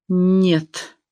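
a dry-mouthed croaked no; hoarse croak. A narrator's reading. Susie answers the doctor, hoarse from a dry mouth. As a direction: rasp it out through a parched throat. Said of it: "прохрипела Сьюзи пересохшим ртом".

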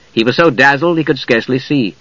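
usual speaking voice

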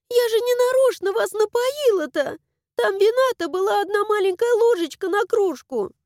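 put-on high, eager girl voice rattling on; rapid chatter. A narrator's reading. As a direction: gabble the apology breathlessly. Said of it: "затараторила".

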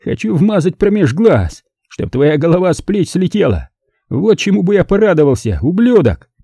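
Larry taunts the narrator at gunpoint, raging; hoarse with rage. A narrator's reading. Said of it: "Хриплым от злобы голосом".

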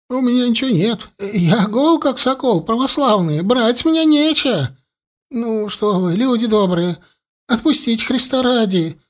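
высоко, запуганно, наперебой; мужичок, перепуганный, молит отпустить; испуганный гомон. A narrator's reading. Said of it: "загомонил мужичок". (испуганно голосит)

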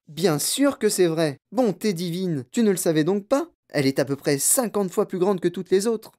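high, emphatic amazement; animated, incredulous storytelling voice; exclaiming in chorus. A narrator's reading. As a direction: exclaim it, astonished anyone could doubt it. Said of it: "s'écrièrent-ils en chœur".

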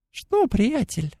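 high, thin mocking fairy voice; chittering pixie. A narrator's reading. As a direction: tease in a tiny insect voice. Pixie creature voice held.

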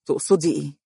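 a touch of caution; guarded short question; wary. A narrator's reading. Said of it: "وقال بحذر".

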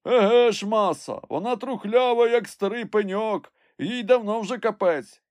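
high, loud confirming call; servant calling down. A narrator's reading. Climber tells master the branch is rotten, high call. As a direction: call the bad news down from above.

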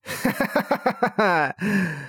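high cackle, falling away; long run of triumphant laughter; gleeful villain laugh. A narrator's reading. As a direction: laugh high and lightly, gloating. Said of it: "громко засмеялась".

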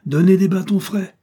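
ordre crié, plus aigu et fort; cri. A narrator's reading. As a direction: lance l'ordre à pleine voix. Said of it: "cria-t-il". (le colonel crie l'ordre aux soldats, fort et aigu)

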